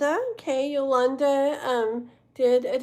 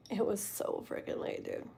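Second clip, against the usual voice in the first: whisper; 4 semitones lower, 12 dB quieter than usual. Real, not acted. Recalls staying up late.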